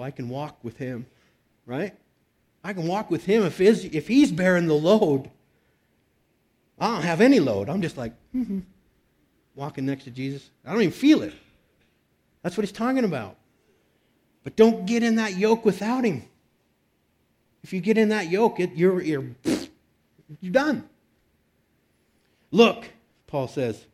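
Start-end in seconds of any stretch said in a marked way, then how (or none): 2.6-20.9 higher, louder preaching, pressing the warning; preaching at full force. Acted. Pastor warning the congregation, preaching high and loud. Preacher building about the yoke. 22.5-22.8 sudden loud, high "Look"; emphatic. Retold or imitated. Pastor voicing Paul's emphatic call to the church, loud. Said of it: "that's pretty emphatic".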